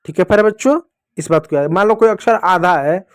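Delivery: ordinary delivery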